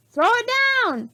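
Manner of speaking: captain's high, loud greedy order; crying out. A narrator's reading. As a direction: yell up at the window. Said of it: "cried Captain Bones".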